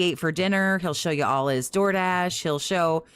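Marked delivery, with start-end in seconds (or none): none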